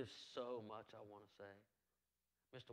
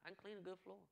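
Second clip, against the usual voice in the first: lightly higher mock voice of Heather saying "I can clean a good floor"; imitating Heather; 8 semitones higher, faster than usual. Pastor playfully voicing Miss Heather's boast, higher. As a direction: pitch up a little to play Miss Heather. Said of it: "Ask Miss Heather".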